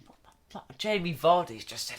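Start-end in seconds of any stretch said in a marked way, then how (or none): 0.1-0.8 hushed, unvoiced disbelief under the breath; whispered thought. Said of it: "I was thinking".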